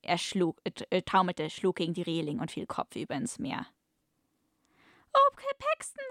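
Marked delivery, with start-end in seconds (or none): 5.1-6.0 much higher mock-girlish voice, louder than a breath; a hammed-up falsetto damsel sighing his name; breathed tenderly. Rescued woman to her hero, mock-girlish falsetto sigh. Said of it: "hauchte sie zart".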